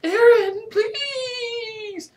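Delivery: high squealed plea, drawn out; desperate high-pitched pleading. A narrator's reading. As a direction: beg in a very high wail. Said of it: "I pleaded".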